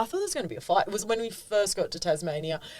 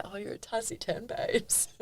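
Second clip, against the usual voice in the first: teasing; 3 semitones higher, 8 dB quieter than usual. Retold or imitated. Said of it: "and I said that".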